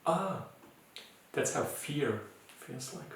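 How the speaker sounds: very gentle, loving self-talk. Shown as a demonstration. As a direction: greet it softly and tenderly. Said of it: "very loving voice and say".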